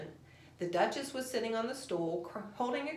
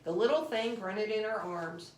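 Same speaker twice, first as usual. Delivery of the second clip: ordinary delivery, nothing marked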